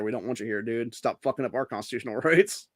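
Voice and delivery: normal talking voice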